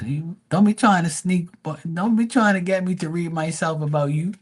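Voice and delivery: calling out a viewer in a mock suspicious voice. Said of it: "I was like".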